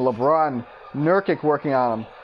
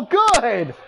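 higher, punched exclamation; excited call; 5 semitones higher than usual. Real, not acted. Commentator calls Ingram's three ball, excited. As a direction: celebrate the made shot in one burst.

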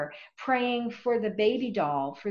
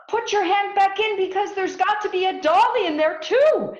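put-on much higher, louder excited child voice; excited children; 12 semitones higher, 11 dB louder than usual. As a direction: plead eagerly like little kids. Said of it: "then the children say".

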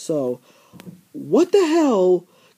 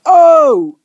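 mock surprise; 7 semitones higher, 9 dB louder than usual. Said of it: "she's like".

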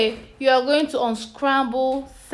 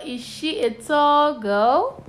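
put-on "is she a tall girl" with "girl" swept upward; question tune; 4 semitones higher than usual. Shown as a demonstration. Teacher repeating the arranged sentence as a question, "girl" rising. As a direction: ask it with a clear rise on "girl". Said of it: "This one is a question mark".

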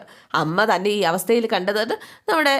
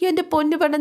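pitched up, mock exasperated retort; exasperated husband; 9 semitones higher, faster than usual. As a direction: toss it off like a fed-up husband. Husband scolds wife in retold scene. (പരിഹാസത്തോടെ)

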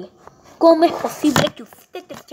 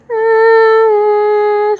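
high hum; 11 semitones higher, 9 dB louder than usual. Acted. Grieving hum before the vow.

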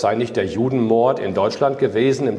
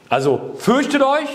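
mock alarmed question; 13 semitones higher than usual. Rhetorical question to congregation.

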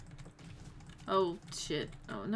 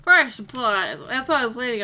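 loud excited cheer; 13 dB louder than usual. Real, not acted. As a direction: celebrate loudly. Celebrating first kill.

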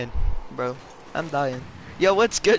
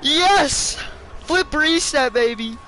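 triumphant cheer; 8 semitones higher, slower than usual. Real, not acted. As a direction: celebrate the big play. Celebrating in-game play.

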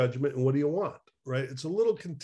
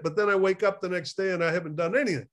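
ordinary talk

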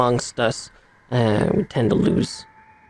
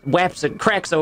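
ordinary talk, nothing marked

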